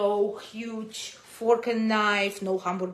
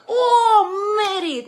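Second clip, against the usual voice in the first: high, loud gushing "oh"; mock elation; 12 semitones higher, 9 dB louder, slower than usual. Woman mocking an easy yes to marriage, mock elation. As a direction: squeal it in hammed-up delight.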